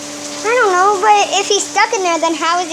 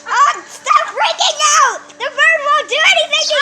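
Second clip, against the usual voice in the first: high-pitched, urgent chiding; calm a screamer while worked up yourself; excited scolding; 7 semitones higher than usual. Real, not acted. Chiding friend amid screaming.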